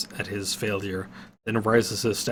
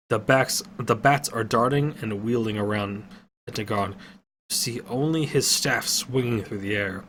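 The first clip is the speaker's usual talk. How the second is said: plain reading voice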